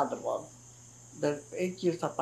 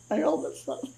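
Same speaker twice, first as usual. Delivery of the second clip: ordinary talk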